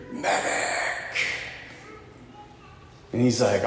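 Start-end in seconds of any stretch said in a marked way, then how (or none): 0.1-1.2 hushed, breath-only far-off call for help; distant cry. Retold or imitated. Wounded soldier calls for a medic in the distance, faint far-off cry. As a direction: call out as if from far away, barely audible. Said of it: "way in the distance, you hear".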